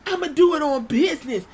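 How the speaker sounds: high, loud put-on tough girl voice; mock tough talk. Vlogger mocking Mia Yim's tough-girl promo, high and loud. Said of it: "you don't talk like that".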